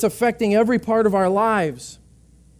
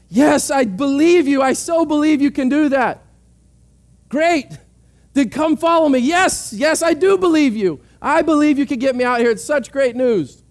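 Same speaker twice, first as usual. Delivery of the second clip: higher and louder, over-eager yes; gushing lost man; 6 semitones higher, 3 dB louder than usual. Retold or imitated. Lost man gushing yes to the ranger while sitting still, excited. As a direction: gush agreement but never move.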